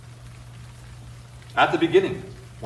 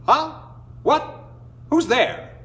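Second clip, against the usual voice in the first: startled coyote; 12 semitones higher than usual. Acted. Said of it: "said Coyote Man".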